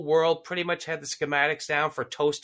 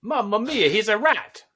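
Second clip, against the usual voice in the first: put-on hammy Italian accent, pitched up; 4 semitones higher than usual. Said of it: "did her Italian accent".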